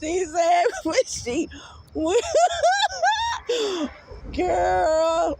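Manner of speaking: high squeaky words through real laughter; barely getting words out for laughing; laughing hard. Real, not acted. Retelling the friend's piano story, cracking up with real laughter. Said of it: "some tears will come down my face".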